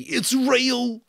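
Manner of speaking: very high, loud terrified cry; panicked cry. A narrator's reading. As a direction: shriek it in fear. Piggy panicking.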